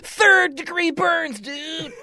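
high, slow, hammed-up yell of third degree burns; cartoon shout. Host mocking the pizza attack with a goofy shout. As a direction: stretch it out like a goofy cartoon hero.

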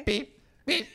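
high, chirpy robotic beep beep; beeping sound. Shown as a demonstration. Showing friends the stress beep, chirpy and high. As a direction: imitate a little stress alarm, high. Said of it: "A beeping sound when you're stressed".

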